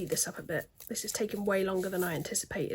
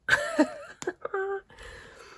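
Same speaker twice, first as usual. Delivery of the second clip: laughing; 12 semitones higher than usual. Real, not acted. Laughing at the chunky mascara.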